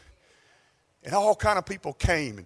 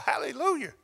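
ordinary talk

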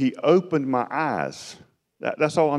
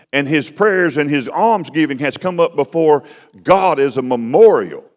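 louder, higher preaching build; rising preaching; 4 semitones higher, 3 dB louder than usual. Acted. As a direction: preach it up, hammering each clause.

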